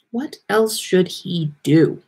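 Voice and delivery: ordinary delivery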